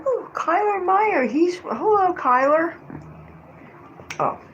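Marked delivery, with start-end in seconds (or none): 0.1-2.8 excited greeting. Real, not acted. Greeting viewer on camera.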